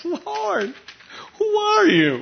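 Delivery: high, fearful asking; trembling question. Acted. Shaking Saul asking the voice who it is, high and afraid. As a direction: ask in awe and fright.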